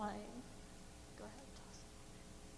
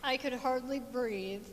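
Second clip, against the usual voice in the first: normal talking voice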